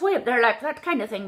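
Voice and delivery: high, quick little leprechaun voice; comical leprechaun. Shown as a demonstration. Coach showing too high an accent, comical leprechaun. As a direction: pitch it way up, comically Irish. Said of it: "comical, like your little leprechaun".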